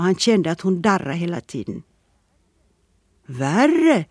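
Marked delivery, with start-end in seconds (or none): 3.3-4.0 mock dismay. A narrator's reading. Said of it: "med låtsad förfäran".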